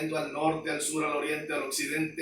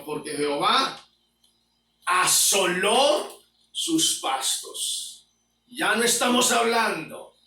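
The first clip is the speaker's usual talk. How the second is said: fiery preaching; 3 semitones higher, 3 dB louder than usual. Acted. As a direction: thunder the warning at the congregation. Preaching at full force.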